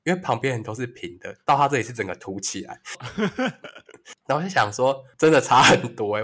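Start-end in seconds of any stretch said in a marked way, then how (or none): 2.8-3.7 a short high giggle; quick giggle at own story; high breathy laugh. Real, not acted.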